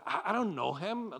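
preacher voicing a flustered denial; acted denial. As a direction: deny it hastily, tripping on the first word. Peter's denial acted out in sermon.

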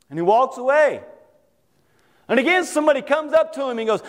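ordinary delivery

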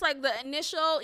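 ordinary talk, nothing marked

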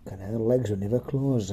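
normal talking voice

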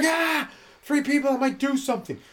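high, slow hammed-up fright; silly panicked whine; mock panic. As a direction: squeal it like a scared kid. Mock panicked self running off.

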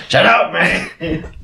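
drawn-out comic impression; Dave Chappelle. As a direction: stretch it out slowly as a mock complaint. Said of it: "It sounded like Dave Chappelle".

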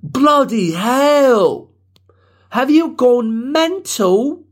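high, slow, drawn-out British outrage; mock British accent. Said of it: "people experiment with voices all the time".

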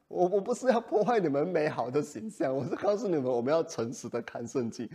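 normal talking voice

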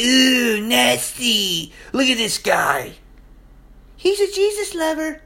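high-pitched jeer, imitating scornful onlookers; mock disgust. Preacher acting out people who'll hate you, mock disgusted jeer. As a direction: mimic people sneering at a believer.

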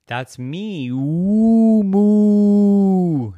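words swelling into a loud moo; proud cow announcing itself; mooing fairy cow. Acted. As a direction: end in a long, loud, high moo.

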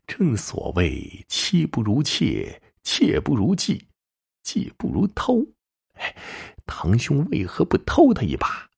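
amused goading. A narrator's reading. Cousin eggs on Yang Jin to steal her, amused goading. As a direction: egg him on, teasingly, voice rising phrase by phrase. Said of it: "杨楠笑道".

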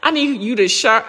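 ordinary talk

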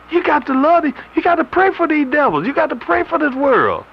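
high mock voice of the critics. Preacher mocking critics, high sarcastic tone.

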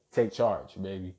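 low, soft imitation of a man's come-on; quoted seductive dude. Man in the scene to his woman, imitated low come-on. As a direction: drop low and quiet, quoting him. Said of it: "and he says, and I quote".

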